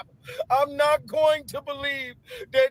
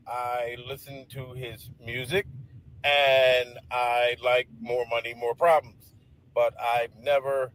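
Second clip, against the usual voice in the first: much lower, calm mock-presidential testimony; Barack Obama; 12 semitones lower than usual. Imitating Obama testifying on the stand, low and calm. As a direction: play Obama calmly denying on the stand. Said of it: "I can imagine Barack Obama on the stand talking about".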